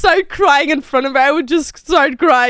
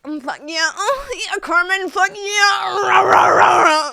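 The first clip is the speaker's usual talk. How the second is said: mock sultry moaning; 6 semitones higher than usual. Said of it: "I'm not like".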